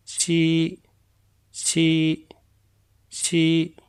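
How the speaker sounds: "ची" repeated slowly, each one crisp and separate; Chinese syllable drill. Shown as a demonstration. Teacher drilling "ची" for students to repeat after her. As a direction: model "ची" clearly for the class to echo. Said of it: "मेरे साथ पढ़िए".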